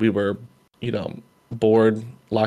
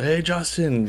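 retold call-out; 7 semitones higher, slower than usual. Coach spotting a fan walking back to his seat, retold call-out. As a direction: imitate a coach hollering hello, pitch raised. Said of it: "Coach Joe screams out".

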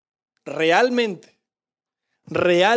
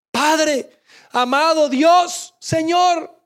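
1 anguished cry; 6 semitones higher, 5 dB louder, much slower than usual. Acted. Jesus crying out on cross.

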